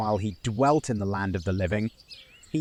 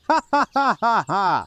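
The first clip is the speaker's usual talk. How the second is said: high, loud bursts of laughter; ringing mocking laugh; 10 semitones higher, 6 dB louder than usual. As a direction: burst out laughing at an absurd claim. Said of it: "Why are you laughing?".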